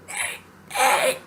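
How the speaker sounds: weak, voiceless imitation of calling out; sore-throat croak. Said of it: "'cause my throat was hurting so bad".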